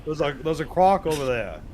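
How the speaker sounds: high-pitched Aussie croc hunter; Australian accent. Pretend. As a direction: play a lightly voiced Aussie bushman. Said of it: "that's the best I got".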